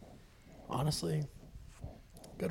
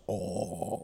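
low, softer imitation of a death metal voice; mock deep voice; 8 semitones lower, 5 dB quieter than usual. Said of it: "his voice is so deep".

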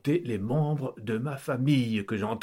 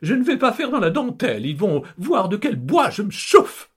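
much higher, louder, fast and fiery; fierce countess; 10 semitones higher, 6 dB louder than usual. Acted. Countess vowing to fight police, high fiery voice. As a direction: threaten with gleeful fury.

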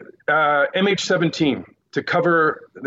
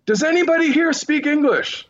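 retold plea called out across a room; yelling out; 14 semitones higher than usual. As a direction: call it out high for anyone to hear. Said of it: "And I yelled out".

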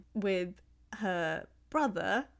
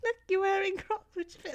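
much higher, cooing over the shoes; gushing onlookers; 13 semitones higher, 5 dB louder than usual. Retold or imitated. Imitating the group noticing her decorated Crocs, much higher. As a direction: ham up the adults admiring her shoes. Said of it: "everyone's like".

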